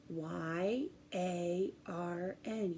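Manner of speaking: letters spelled out slowly and softly; slow spelling. Shown as a demonstration. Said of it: "spelled right there".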